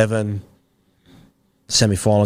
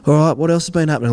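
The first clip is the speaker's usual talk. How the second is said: Cliffy chatting; 6 semitones higher, 4 dB louder, faster than usual. Retold or imitated. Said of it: "He goes".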